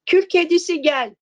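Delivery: mocking call. A narrator's reading. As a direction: call her name in mockery. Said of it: "alay ederek".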